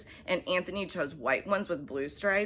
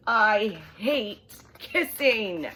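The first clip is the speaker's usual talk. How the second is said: slow, louder drawn-out disgust; disgusted whine; 4 semitones higher, 6 dB louder, much slower than usual. Acted. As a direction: stretch it out in disgust. Child disgusted.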